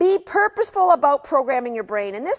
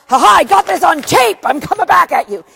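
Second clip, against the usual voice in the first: high, loud, gleeful gotcha taunt; mock gloating; 7 semitones higher, 10 dB louder than usual. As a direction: crow over catching someone on tape. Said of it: "And it's not about".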